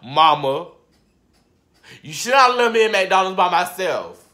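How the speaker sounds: loud, fake-wounded call to mama; play-accuse mama, loud and whiny; mock blame. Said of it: "I'm just playing".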